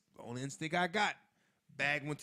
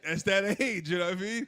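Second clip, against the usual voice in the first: normal talking voice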